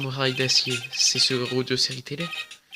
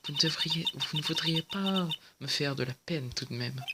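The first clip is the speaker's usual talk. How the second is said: much softer, pouting plea; guilt-trip him sweetly; mock hurt; 2 semitones higher, 7 dB quieter than usual. Witness pretends to be hurt. (plainte boudeuse plus douce)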